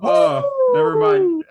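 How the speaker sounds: shrieking yelp. Real, not acted. Sudden high yelp reversal.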